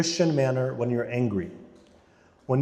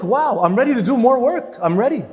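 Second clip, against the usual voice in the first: higher, louder, eager and rested; refreshed eagerness; 7 semitones higher, 5 dB louder than usual. Retold or imitated. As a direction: bounce up ready for more. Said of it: "You're like".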